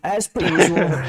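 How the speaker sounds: voice changer. Real, not acted. Voice mod switched on.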